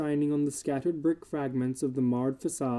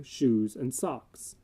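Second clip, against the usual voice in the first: usual speaking voice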